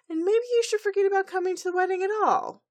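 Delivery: high, fast angry outburst; hammed-up furious brother. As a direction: snap at the sister. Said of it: "Shining Armor's like".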